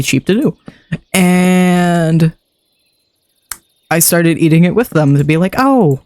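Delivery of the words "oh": coaxing. Retold or imitated. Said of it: "'cause kid logic".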